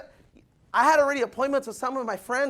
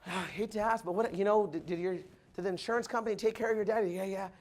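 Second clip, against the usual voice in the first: much softer, confiding; hushed retold dialogue; 5 semitones lower, 5 dB quieter than usual. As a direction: lean in and tell it low. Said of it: "He said".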